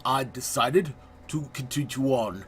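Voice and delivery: playful, hammed-up French accent narrating the pirate adventure; a self-confessed terrible French accent, overplayed for laughs; mock French accent. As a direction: put on a deliberately bad French accent, like a cheesy pirate guide. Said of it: "I have a terrible French accent".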